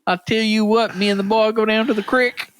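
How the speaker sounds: lightly hammed-up Southern voice; Southern accent. Joining the joke about sounding Virginian, mock Southern accent.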